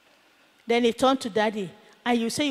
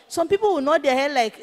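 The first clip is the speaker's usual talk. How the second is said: ordinary talk, nothing marked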